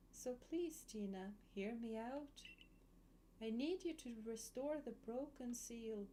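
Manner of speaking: hushed and haunting, like a ghost; eerie voice. Acted. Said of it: "Quite the eerie voice".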